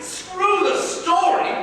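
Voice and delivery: high angry snap; angry outburst. Acted. Piero snapping at Reyna about the old story, angry. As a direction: throw the words back at her. Villain rejects the legend.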